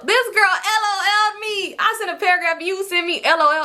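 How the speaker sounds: high-pitched incredulous complaint; outraged. Retold or imitated. Storyteller outraged at being sent LOL for a paragraph, high and incredulous. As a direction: raise the pitch high in disbelief. Outraged retelling of a text reply.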